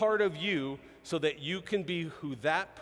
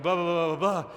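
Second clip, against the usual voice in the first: quicker run of blahs; sped up; 4 dB louder than usual. Retold or imitated. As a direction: imitate a speech picking up speed. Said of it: "they speed it up a little bit".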